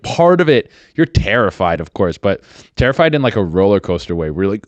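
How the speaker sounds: normal talking voice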